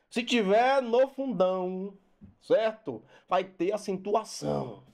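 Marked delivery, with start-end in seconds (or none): none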